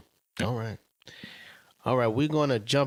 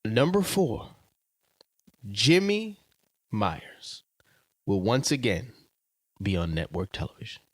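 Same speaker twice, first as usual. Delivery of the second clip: announcer read; 2 semitones higher, slower than usual. Real, not acted. Host reading out a statement for guest to judge, announcer voice.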